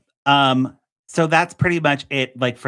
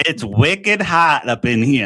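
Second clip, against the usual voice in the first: slow, hammed-up Boston accent leaning on wicked; clumsy mock Boston accent, a touch louder; bad Boston accent; 4 dB louder, slower than usual. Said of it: "I'm gonna try to do my Boston accent here".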